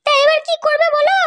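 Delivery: slow, high mother's voice, pressing; the mother needling her husband, slow and high. Acted. Mother asking the father what he will do now, slow high voice.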